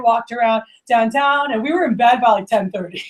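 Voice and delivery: ordinary delivery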